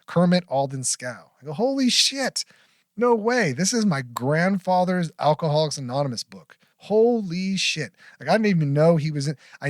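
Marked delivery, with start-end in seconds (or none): 1.5-7.9 high-pitched, astonished disbelief; amazed, voice lifting high; retold amazement. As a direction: relive the stunned discovery, pitch jumping up. Retells his amazement at the find.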